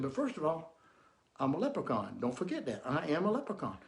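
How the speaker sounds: low, soft, knowing insistence; sly leprechaun. Retold or imitated. Leprechaun quietly reminds the drunk man who he is.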